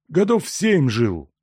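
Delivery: slow, hoarse peasant voice. Acted. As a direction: rasp it out slowly, hoarse. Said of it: "произнёс сиплым голосом мужик".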